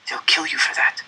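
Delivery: hushed warning whisper; whispered warning to a neighbour. Acted. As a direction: breathe the warning under the guards' notice. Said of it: "a man next to me said".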